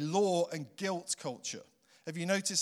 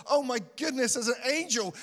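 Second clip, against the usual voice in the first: higher mock gasp of surprise; freaked out; 7 semitones higher than usual. Preacher imitating Mary seeing an angel, higher mock surprise. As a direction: play Mary startled by the angel. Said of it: "Was like".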